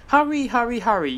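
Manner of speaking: high, loud puffing chant like a big engine; puffing like Gordon. A narrator's reading. Thomas pretending to be big engine Gordon, puffing. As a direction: puff it out grandly, playing Gordon. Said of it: "pretending to be like Gordon".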